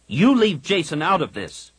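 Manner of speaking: snarled. A narrator's reading. Jaina snarling at Kip to leave her brother out. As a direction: bite it out in defence of her twin. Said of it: "Jaina snarled".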